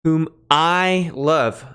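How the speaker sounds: I leaned on, slow and drawn out; stress on I. Shown as a demonstration. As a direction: hit the word I in whom I love, slowly. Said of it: "Emphasis on the I".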